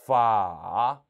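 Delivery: long 파 dipping low, then rising; third tone. Shown as a demonstration. Teacher naming 삼성, 파 in a dipping tone. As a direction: pull 파 down and lift the end. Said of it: "삼성입니다".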